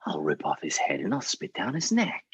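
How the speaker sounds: low, menacing whisper; whispered threat. Acted. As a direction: whisper it as a furious king's threat. King's stunned rage, acted.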